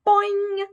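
high, loud springy boing; ping it out like a spring; frog's bright twanging boing. Acted.

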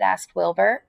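ordinary delivery

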